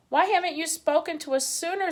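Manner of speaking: high-pitched screeching owl. A narrator's reading. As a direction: screech down from the tree. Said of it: "screeched the owl".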